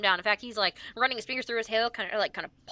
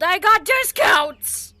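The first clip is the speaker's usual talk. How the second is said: drawn-out, louder punchline; slow wry quip; 6 semitones higher, 8 dB louder, much slower than usual. Acted. Wizard jokes to the party, slow and louder. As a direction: savour each word of the joke. Wizard NPC joking about age.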